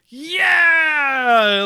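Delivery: long, high, loud shout; imitated victory cheer. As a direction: cheer like a whole roomful at a win. Imitates family cheering the win.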